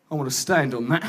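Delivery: low, dopey arrogant voice; smug dim surfer. Retold or imitated.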